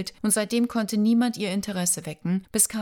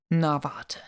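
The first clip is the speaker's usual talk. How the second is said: teasing threat; 3 semitones lower than usual. Acted. Playful threat to teasing lover.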